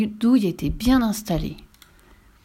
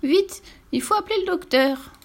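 very high urgent cry for help; flustered granny calling for the doctor; high, alarmed urgency; panicking grandmother; 10 semitones higher than usual. Acted. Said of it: "s'affola sa grand-mère".

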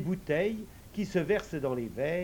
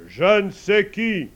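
slow, much louder commanding call; majestic old man calling; 5 semitones higher, 13 dB louder, slower than usual. Acted. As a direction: summon the invisible servant like a lord. Old man calls again.

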